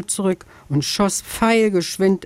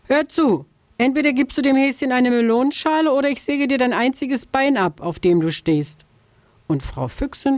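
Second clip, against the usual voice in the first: higher, quick sly fox threat; threatening vixen; 7 semitones higher than usual. Acted.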